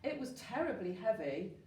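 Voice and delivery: plain reading voice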